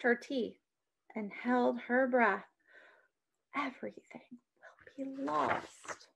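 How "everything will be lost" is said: frightened whisper. A narrator's reading. Girl hiding from a spy, frightened whisper. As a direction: breathe it, barely voiced. Hiding, holding her breath.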